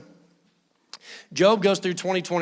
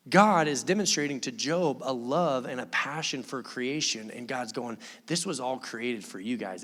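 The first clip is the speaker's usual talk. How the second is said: softer, lower, intimate God speaking to his people; tender God; 6 semitones lower, 4 dB quieter than usual. Retold or imitated. Preacher voicing God telling Job about creation, soft and tender. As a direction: gently remind them, with love.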